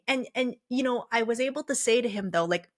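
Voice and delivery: ordinary talk, nothing marked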